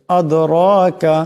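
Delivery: আদরাকা with the দ bounced and the রা said heavy; qalqala on dal. Shown as a demonstration. Teacher showing students the echoing দ in আদরাকা. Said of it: "দাল কলকলার হরফ".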